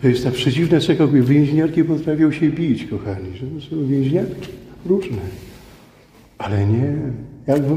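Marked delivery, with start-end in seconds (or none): none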